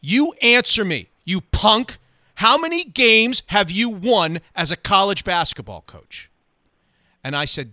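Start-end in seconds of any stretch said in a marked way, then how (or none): 0.0-6.3 high, loud, hammered demands; screaming rage. Retold or imitated. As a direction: jab a finger and yell in his face. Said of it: "he starts screaming".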